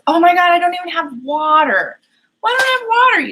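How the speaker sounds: high, loud burst of dismay; dismayed realisation. Real, not acted. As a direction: exclaim it high, just caught out with something missing. Suddenly finds she has no water.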